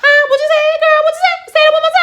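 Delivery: high, loud and rising taunt of what you said; loud challenge. Retold or imitated. Ambassador daring Serena to repeat herself, loud and high. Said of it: "the ambassador was like".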